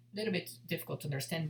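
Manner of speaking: usual speaking voice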